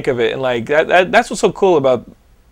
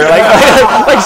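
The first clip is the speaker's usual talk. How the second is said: normal talking voice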